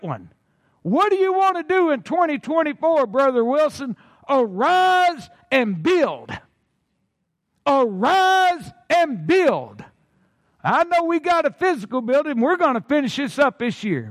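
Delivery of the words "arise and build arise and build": slow preaching. Acted. Repeated slow proclamation.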